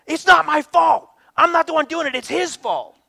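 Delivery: high, loud indignant whine; indignant child. Retold or imitated. Daughter protesting to dad it's not her fault, indignant whine. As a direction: protest your innocence like a wronged kid. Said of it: "she gets so indignant".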